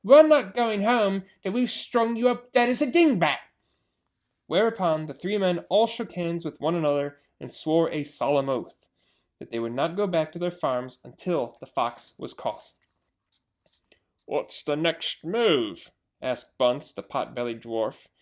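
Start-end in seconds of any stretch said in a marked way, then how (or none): 0.0-3.4 higher, louder gloating threat; menacing shout. Acted. Bean shouting down the hole at the fox, menacing. 14.2-15.9 slow dwarf farmer. Acted. Bunce asking.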